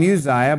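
Uzziah as "you-ZYE-uh"; his habitual pronunciation. Shown as a demonstration. Preacher giving his own habit, Uzziah pronounced "you-ZYE-uh". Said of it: "I've always called him".

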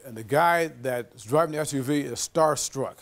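ordinary delivery

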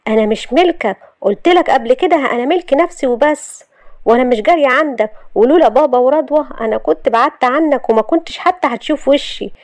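defiant. Acted. Shahd telling Youssef she is not his property, defiant.

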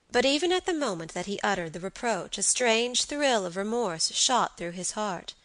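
ordinary delivery

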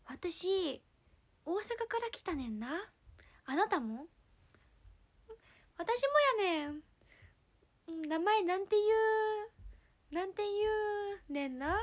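exaggerated Kansai lilt, feeling for the endings; pretend Kansai dialect. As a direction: improvise a halting chat in fake Osaka dialect. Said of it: "じゃあ関西弁ね。".

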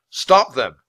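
loud, forceful order; commanding royal shout; urgent command. A narrator's reading. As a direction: call your men into action. King orders his men to act.

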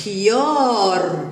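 خيار with the aa stretched slowly; long drawn-out vowel. Shown as a demonstration. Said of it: "فيها مد بالألف".

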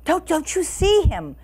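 high-pitched feigned alarm; mildly hammed-up storybook cry. A narrator's reading. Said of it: "cried Sense Pass King".

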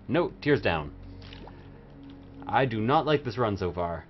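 ordinary talk

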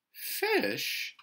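very high, startled "Fish"; "Fish" drawn out in high-pitched disbelief; surprised. A narrator's reading. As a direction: echo "Fish" in motherly surprise. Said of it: "Missus Huggins sounded surprised".

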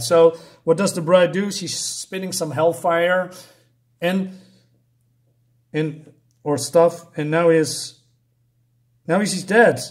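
usual speaking voice

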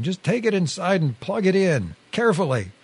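high-pitched, restrained yell; mock screaming. A narrator's reading. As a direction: act out his screaming without full volume. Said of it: "now he's back to screaming".

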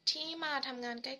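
ordinary talk, nothing marked

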